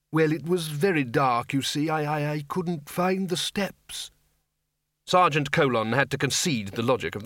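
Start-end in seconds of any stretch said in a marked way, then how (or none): 0.1-4.1 slow, faltering put-on elder; apologetic, stumbling over the words; mild old wizard. Undead wizard explaining to sergeant, faltering.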